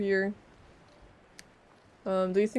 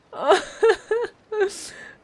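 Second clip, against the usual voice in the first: a high squealing laugh of delight; 12 semitones higher, 4 dB louder than usual. Real, not acted. Streamer spotting a mushroom in a hat, high squealing laugh. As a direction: squeal at something cute.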